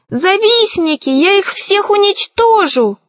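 high-pitched threat from the sorcerer; outraged cry. A narrator's reading. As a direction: rail at them in a high voice. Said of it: "возопил колдун".

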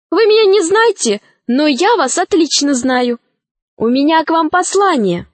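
put-on high-pitched, earnest girl voice; Алиса. A narrator's reading. Alisa brings the dragon a message, high girlish voice. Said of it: "сказала Алиса".

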